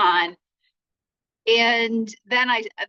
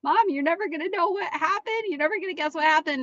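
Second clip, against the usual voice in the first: higher, fast and breathless daughter's voice; mother mimicking her crying daughter on the phone, higher and fast; imitating upset daughter; 8 semitones higher, faster than usual. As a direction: rush it out like a panicked ten-year-old. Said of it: "she's kind of crying".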